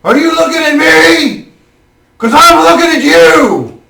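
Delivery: high, loud mocking brute voice; loud challenge to Shadrach; tough guy challenge. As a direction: square up and taunt him. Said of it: "And he says".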